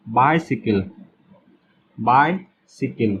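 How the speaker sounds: बाइसिकल drawn out slowly, stressing its i; बाइसिकल said slowly for the class; short i sound. Shown as a demonstration. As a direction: stretch बाइसिकल to show the short i. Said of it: "छोटी ई आ रहा है बाइसिकल".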